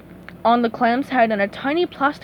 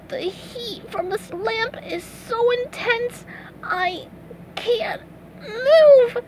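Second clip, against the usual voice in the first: weak; 11 semitones higher, much slower than usual. A narrator's reading. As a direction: strain out the words, wilting in heat. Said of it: "SpongeBob said weakly".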